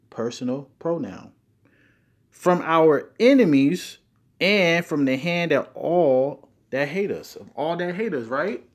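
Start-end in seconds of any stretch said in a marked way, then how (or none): 2.3-7.3 slow and louder, punching each word; emphatic reading. Shown as a demonstration. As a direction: drive "from our enemies" hard. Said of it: "if you put emphasis on certain words".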